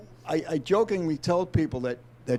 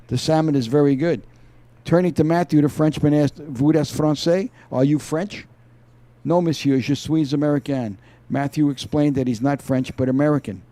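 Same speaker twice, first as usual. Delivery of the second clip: reading a novel aloud, a bit louder; story reading; 7 dB louder than usual. Acted. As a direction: read the opening page like an audiobook. Reads opening chapter aloud.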